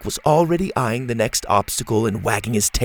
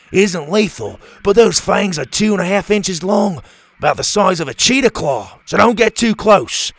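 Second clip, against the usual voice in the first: lightly voiced eager expert, higher at times; enthusiastic scientist; 7 semitones higher, 4 dB louder than usual. Doctor Rex telling Bo about tarantulas, eager lightly voiced expert. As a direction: gush about the spiders' legs.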